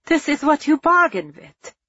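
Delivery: high, loud scornful jeer; mocking. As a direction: throw it back at him mockingly. Captain mocking after.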